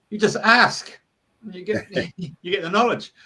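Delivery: high little pixie voice, chuckling through. Retold or imitated. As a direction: play the wise little pixie, amused. Pixie explains knowledge.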